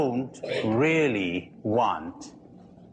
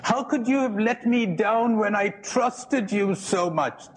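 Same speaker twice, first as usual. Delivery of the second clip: higher, hurt and accusing; mock wounded reproach; 7 semitones higher than usual. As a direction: play the betrayed lover, a little whiny. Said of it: "So you prefer to say".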